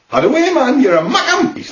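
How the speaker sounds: lively Newcastle lilt, key words leaping high; broad Geordie with swooping place names; Geordie accent. Shown as a demonstration. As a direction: play a chirpy Geordie. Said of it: "people from Newcastle are called Geordies".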